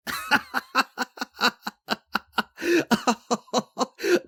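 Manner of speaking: long, high peal of laughter; high character cackle of amusement. Acted.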